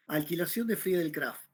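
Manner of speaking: normal talking voice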